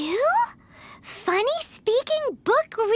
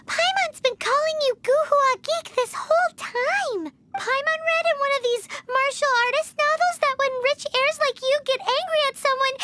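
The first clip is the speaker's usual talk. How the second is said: ordinary talk, nothing marked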